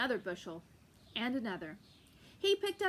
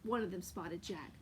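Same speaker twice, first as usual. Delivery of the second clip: plain reading voice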